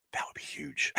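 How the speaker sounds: hushed excited whisper. Real, not acted. Host dreaming of an upset, whispered. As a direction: breathe it like a secret hope.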